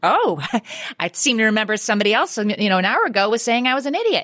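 snide told-you-so, hammed-up; sarcastic gloating. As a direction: rub it in with mock triumph. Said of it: "opportunity to say".

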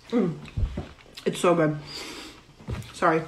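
talking through a big bite; cheeks stuffed full. Real, not acted. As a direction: mouth full of lasagna. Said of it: "talk with my mouth full".

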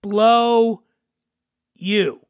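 drawn-out words with a pause between; very slow. Shown as a demonstration. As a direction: stretch each word, leave a gap. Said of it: "say very slowly".

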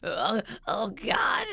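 mock sobbing, gasping child; hammed-up wail of a crying little girl; imitated crying. Mom mimicking daughter after scooter fall, mock crying. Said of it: "she just starts crying".

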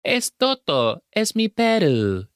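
put-on high, earnest Spanish with a thick accent; mock Spanish line. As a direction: lift the voice and say it like a kid in a play. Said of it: "but she says".

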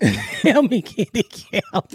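slow, laughing through the punchline; laughing repeat. Real, not acted. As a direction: crack up as you say it again.

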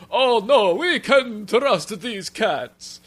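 put-on high, slow, loud fretful old mouse; a naive worried old papa, dragged out; Papa Mouskowitz voice. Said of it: "my Papa Mouskowitz voice".

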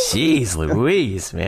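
usual speaking voice

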